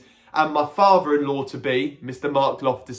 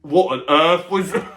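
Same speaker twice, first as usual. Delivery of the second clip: laughing; 4 dB louder than usual. Real, not acted. Reacting to her odd noise.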